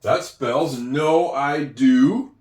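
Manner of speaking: slow reading. Shown as a demonstration. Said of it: "reading back the word".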